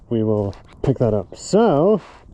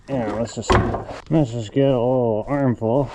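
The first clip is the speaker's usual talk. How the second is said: ordinary delivery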